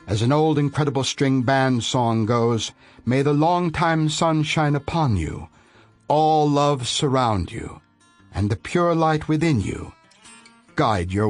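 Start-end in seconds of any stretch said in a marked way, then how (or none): none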